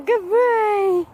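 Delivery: high, loud dog praise; sing-song praise to a good dog; excited praise. Real, not acted. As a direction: cheer the dog on brightly.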